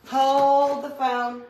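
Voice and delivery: hammed-up stop-everything call; dramatic call. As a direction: announce it like a halt order. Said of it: "repeats the phrase twice".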